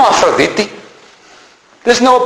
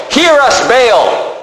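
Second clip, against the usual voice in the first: higher, pleading call to a god; calling upon Baal; 5 semitones higher, much slower than usual. Acted. Prophets of Baal calling on their god, raised imploring call. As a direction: call out as if to the sky. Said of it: "they start calling upon Baal".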